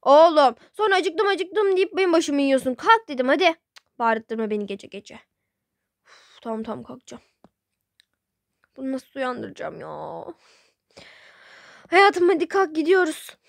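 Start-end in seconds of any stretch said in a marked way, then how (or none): 0.0-5.2 scolding mother. Acted. Mother scolds child at night. 11.9-13.4 loud wake-up call. Acted. Wife wakes husband for sahur.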